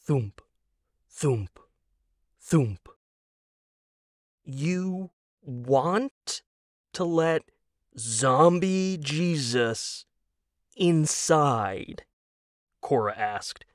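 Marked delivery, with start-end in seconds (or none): 4.4-12.0 deliberate incredulous slow-down; slow, each word separate. Acted. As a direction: drag out every word at half speed, rising high. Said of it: "releasing each word slowly".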